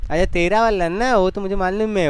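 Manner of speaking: insistent scolding. Real, not acted. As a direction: push him to answer, voice up. Teacher pressing silent student. (जवाब के लिए ज़ोर देती ऊँची आवाज़)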